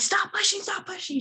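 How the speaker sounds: high-pitched urgent "stop pushing", no real volume; mock yell. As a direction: nurse ordering the mother to stop pushing, mild mock yell. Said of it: "She's, like, yelling at me".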